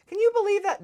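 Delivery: high, loud can-you-believe-it; outraged disbelief. Real, not acted. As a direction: pitch it up in outrage.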